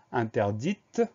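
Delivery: interdite closed on a crisp t sound; audible final T. Shown as a demonstration. Teacher giving the feminine singular, interdite with audible T. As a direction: let the last T of interdite be heard. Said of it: "on va entendre le T muet".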